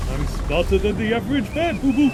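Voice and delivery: high, rising cartoon bear voice; cartoon bear impression. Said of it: "One of the few impressions I can vaguely get".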